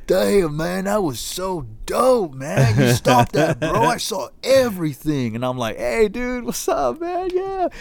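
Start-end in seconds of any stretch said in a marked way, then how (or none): 0.1-5.3 higher, louder laid-back dude voice; impressed hippie. Retold or imitated. Hippie praising him for stopping the cart, hammed-up dude voice. 5.8-7.7 much higher chill bro greeting; casual greeting. Retold or imitated. Greeting the hippie while holding the cart, high chill bro voice.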